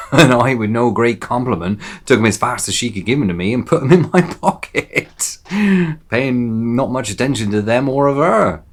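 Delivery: loud, high, chuckling pirate gloat; gleeful boasting. Acted. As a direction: boast loudly with laughter breaking in.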